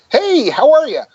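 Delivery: high, bright hello pushed up. Real, not acted. Actor greeting a starstruck fan, pitched high. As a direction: lift the voice way up to sound harmless. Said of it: "I like pitching my voice up".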